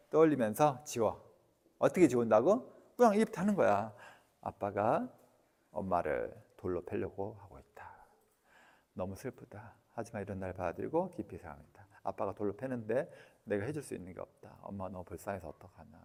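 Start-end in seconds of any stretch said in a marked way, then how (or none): 4.4-16.0 quiet, hushed healing affirmation; softly spoken inner affirmation; soft self-affirmation. Shown as a demonstration. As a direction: murmur it softly to yourself, with acceptance. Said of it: "이렇게 한다고".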